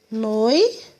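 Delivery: "Noite" as a rising noi, long pause, te; teacher sounding out "Noite"; syllables separated. Shown as a demonstration. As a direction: split "Noite" in two with a wide gap. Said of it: "A gente vai separar a palavrinha assim".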